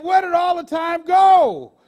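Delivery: put-on loud, slow cry from the old man; old man's loud lament; crying out the old man's question. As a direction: call it out in despair. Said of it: "He said".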